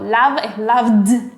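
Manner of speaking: "loved" with an exaggerated final d after plain "Love"; slow, drawn "loved" with its ending released hard; marked past ending. Shown as a demonstration. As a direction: punch out the last consonant of "loved". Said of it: "remarcar el pasado".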